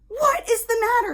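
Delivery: high, loud worried cry; alarmed shout. Retold or imitated. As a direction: call out in alarm. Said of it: "She's like".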